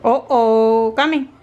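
ordinary talk, nothing marked

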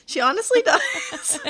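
laughing high and bright. Real, not acted. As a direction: laugh through the words. Laughing agreement.

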